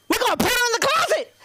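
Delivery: high, fast mock threat; hammed-up gleeful schoolkid; plotting kids. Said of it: "they're like".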